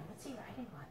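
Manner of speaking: ordinary delivery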